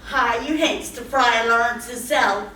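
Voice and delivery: ordinary delivery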